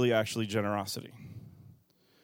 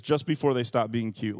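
ordinary delivery

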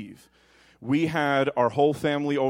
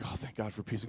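much softer, low relieved sigh; 6 semitones lower, 13 dB quieter, faster than usual. Retold or imitated. Parent during the baby's pause, soft relief. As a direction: breathe it out in mock relief. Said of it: "you're like".

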